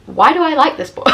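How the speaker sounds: laughing at herself while asking; amused, embarrassed laugh. Real, not acted. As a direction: giggle through the question. Laughing at own crush on Peter.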